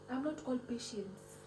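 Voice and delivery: ordinary talk, nothing marked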